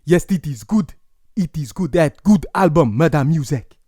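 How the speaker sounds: put-on a careful second try at an African accent; deliberate African accent impression. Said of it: "let me try to do an African accent".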